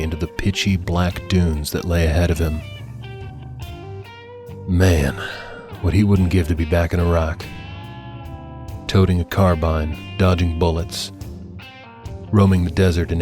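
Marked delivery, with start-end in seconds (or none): none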